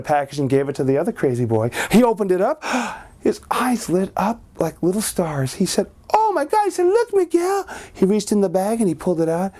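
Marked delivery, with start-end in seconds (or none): 6.1-7.7 put-on very high delighted child; thrilled kid. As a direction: show it off in delight. Boy gets a gift.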